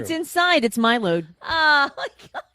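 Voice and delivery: high and loud mock outcry; loud high laughing protest at the joke. Real, not acted. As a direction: yell it out in mock horror. Co-host mocking crude joke.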